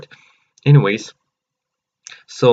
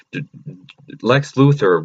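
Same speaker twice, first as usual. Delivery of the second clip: normal talking voice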